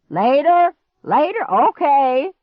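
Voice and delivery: loud, high calls; calling out. Acted. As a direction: holler back cheerfully. Grandpa calling, waving saw.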